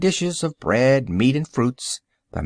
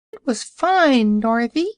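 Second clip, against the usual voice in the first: piglet calling; 11 semitones higher than usual. A narrator's reading. Invisible piglet reassuring Dorothy, high piping call. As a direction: call out high like a small pig. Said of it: "called one of the piglets".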